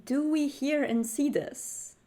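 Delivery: ordinary talk, nothing marked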